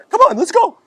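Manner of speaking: exaggerated high, loud voice; high-voiced friend. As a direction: mimic your pal urging you on, very high. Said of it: "He had this high voice".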